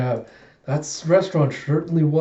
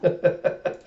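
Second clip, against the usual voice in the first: short breathy chuckle; real chuckle. Real, not acted. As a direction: let out a brief amused laugh. Amused after the recap.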